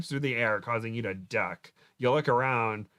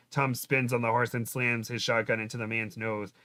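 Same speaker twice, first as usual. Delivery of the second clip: ordinary delivery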